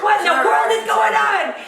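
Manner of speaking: high, alarmed Southern twang; hammed-up Southern accent, laughing through it; thick Southern accent. Wife on the phone demanding answers, thick Southern accent. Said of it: "thick, thick Southern accent on the phone".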